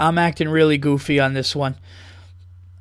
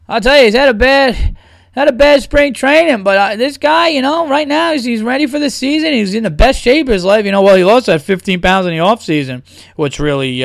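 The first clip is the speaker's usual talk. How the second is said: loud, high play-by-play color man; baseball announcer; 6 semitones higher, 4 dB louder than usual. Pretend.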